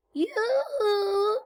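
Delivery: high, sustained singsong hail; calling out. Acted. Witch hails a cow across the meadow, high call. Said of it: "she shouted".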